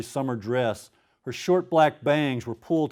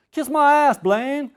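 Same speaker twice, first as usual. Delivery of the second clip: higher, loud Cajun snap at his brother; Cajun boy's retort; 14 semitones higher, 6 dB louder, slower than usual. Acted. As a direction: hurl it back at Blaine in Cajun drawl. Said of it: "I get to do my Cajun accent".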